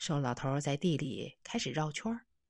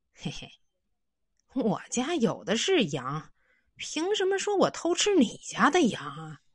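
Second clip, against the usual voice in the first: louder, higher mocking retort; smug sneer; 5 semitones higher, 4 dB louder than usual. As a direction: taunt him with a chuckle. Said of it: "嘿嘿一笑".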